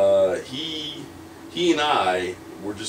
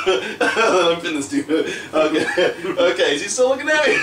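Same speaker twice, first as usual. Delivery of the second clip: giggling through a quoted thought, much higher; laughing inner thought; 7 semitones higher, 3 dB louder than usual. Retold or imitated. Wrestler recalling his thoughts mid-match, laughing. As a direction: laugh it out, pitch climbing up. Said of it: "And I'm just like".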